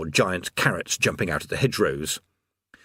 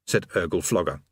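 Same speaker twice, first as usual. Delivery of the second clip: usual speaking voice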